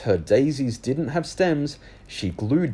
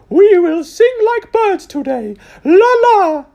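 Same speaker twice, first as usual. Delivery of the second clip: much higher, louder birdlike trill; 12 dB louder than usual. Acted. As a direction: trill it like a bright music teacher. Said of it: "she trilled".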